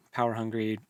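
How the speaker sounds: ordinary delivery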